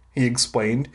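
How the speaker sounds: plain reading voice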